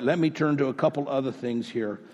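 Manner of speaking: normal talking voice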